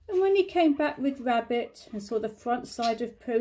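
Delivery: ordinary delivery, nothing marked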